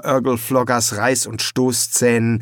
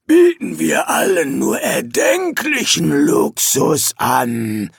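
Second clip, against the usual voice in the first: growled demon line; 3 semitones higher than usual. Acted. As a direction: growl the sales pitch. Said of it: "knurrte Urgle Flogger".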